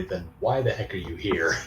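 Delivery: ordinary talk, nothing marked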